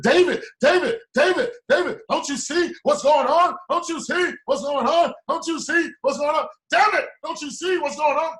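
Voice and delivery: high, loud desperate calling; frantic pleading. Retold or imitated. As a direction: shout at David, begging him to see. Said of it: "I said".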